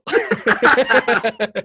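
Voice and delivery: high, loud burst of laughter. Real, not acted. Storyteller laughing at his own accent work, loud laughing.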